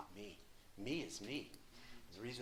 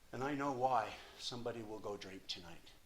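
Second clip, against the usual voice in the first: ordinary talk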